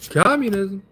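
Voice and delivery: comic outburst landing the joke; punchline blurt. Pretend. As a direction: blurt the word like a sudden punchline. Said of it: "I don't know why I said it like that".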